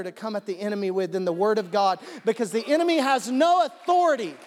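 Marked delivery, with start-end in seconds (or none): 2.2-4.4 high, loud burst of excitement; preacher swept up in excitement, high and loud; real excitement. Real, not acted. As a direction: get carried away preaching. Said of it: "I got really excited on that".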